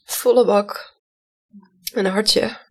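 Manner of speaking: moved, fighting to keep it together; emotional. Real, not acted. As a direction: voice rising with held-back tears. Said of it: "mij ook nu, als ik dit zo vertel".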